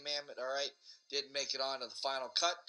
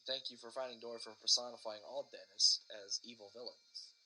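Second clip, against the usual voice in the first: ordinary talk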